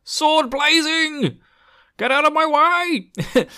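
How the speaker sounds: high, drawn-out hammed-up shout; mock battle cry. Hero rushes into the hallway, mock battle cry. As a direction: bellow it like a charging hero.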